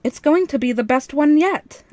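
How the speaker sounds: nervous show-off enthusiasm; piping up. A narrator's reading. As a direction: oversell the excuse with gusto. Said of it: "I piped up".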